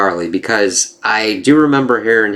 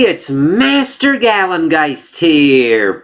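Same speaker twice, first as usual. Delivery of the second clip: high, drawn-out host greeting; show-opener announcement; 10 semitones higher, slower than usual. Acted. Host greets viewers to open a comic review, high announcer intro. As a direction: announce it high, stretching the opening words.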